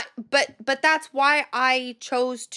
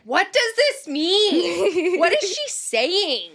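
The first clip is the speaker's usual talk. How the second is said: confused; 8 semitones higher, 4 dB louder, much slower than usual. Retold or imitated. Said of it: "And I was like".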